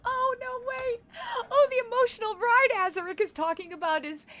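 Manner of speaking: startled oh no wait; sudden realization. Real, not acted. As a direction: gasp as it dawns on you.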